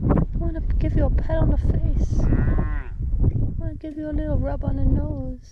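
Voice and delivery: high cooing to the animals. Real, not acted. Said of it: "Don't mind my baby talk".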